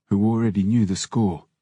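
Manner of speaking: usual speaking voice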